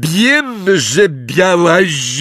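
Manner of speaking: Neville roaring. A narrator's reading. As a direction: bellow at her. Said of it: "ryknął Neville". (wściekły, wysoki ryk Neville'a)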